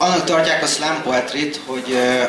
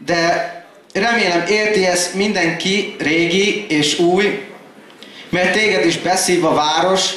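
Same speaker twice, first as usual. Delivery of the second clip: rhythmic poem delivery, higher than casual talk; slam poetry recital; 4 semitones higher than usual. Acted. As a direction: recite in measured phrases, dropping at stanza ends.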